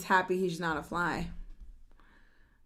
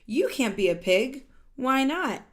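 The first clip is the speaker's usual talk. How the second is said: louder, lively read-aloud of a character line; animated storybook voice; 7 dB louder than usual. Acted. Reading a picture book to children, animated and louder. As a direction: voice the characters brightly for the kids.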